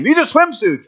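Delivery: high, indignant protest as dad; arguing dad. Retold or imitated. Dad arguing with the park ranger.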